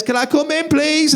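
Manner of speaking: put-on high-pitched small kid pleading; little child's voice. Small child outside the toilet door, little voice. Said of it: "this little voice says".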